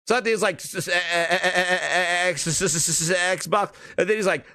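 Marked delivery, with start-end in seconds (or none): 0.6-3.7 exaggerated stutter, fighting to get the word out; mock stuttering. Host mocking Satya struggling to say Xbox, mock stuttering. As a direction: stammer hard on each sound, stuck repeating it. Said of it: "He went from stuttering Satya".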